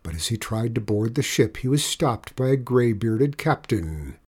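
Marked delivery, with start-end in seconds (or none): none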